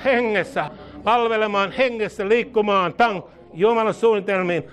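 high, urgent charismatic prayer; fervent prayer. Real, not acted. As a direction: pray over the congregation with building fervor. (kiihkeä rukous)

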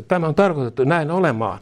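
ordinary talk

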